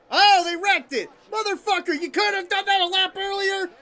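high, loud exasperated yell; outraged fan yelling at full pitch; furious shouting. Real, not acted. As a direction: scream at the screen in frustration. Angry that wreck came too late.

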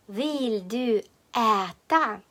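"Vill du äta" slowly, each word apart. Shown as a demonstration. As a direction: dictate "Vill du äta" with drawn-out vowels. New phrase dictated slowly. («Vill du äta» повільно, по словах)

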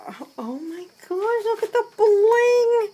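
real high-pitched thrilled squeal; thrilled amazement. Real, not acted. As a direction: gasp it out, then squeal with delight. Overwhelmed as she unveils the gift.

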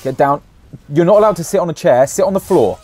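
loud, high playful commands; mock bossy. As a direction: boss him around, hammed-up loud. Jokingly bossing friend around.

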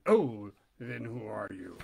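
slow, low sly critter; low polecat voice. Acted.

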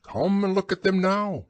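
high-pitched owl voice; high storybook owl invitation; insistent owl. A narrator's reading. Owl invites Pooh outside.

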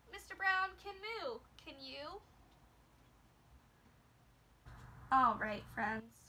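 lively picture-book read-aloud; reading to a toddler. Acted. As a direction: read with big sound effects for kids.

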